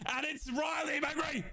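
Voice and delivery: high, drawn-out commentator's shout; excited name call. Real, not acted. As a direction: stretch the player's name out high. Calling the favourite player.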